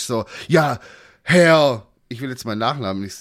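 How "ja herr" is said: snippy. Retold or imitated. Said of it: "wird die so schnippisch".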